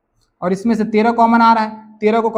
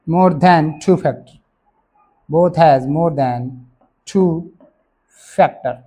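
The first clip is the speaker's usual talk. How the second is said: spaced-out phrases, lower and deliberate; slow dictation; 4 semitones lower, much slower than usual. Real, not acted. Teacher giving students the reason to write, slow dictation. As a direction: dictate slowly, pausing for the writers.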